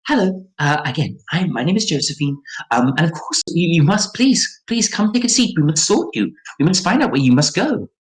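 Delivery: high, insistent little gnome voice; eager gnome Josephine. Acted.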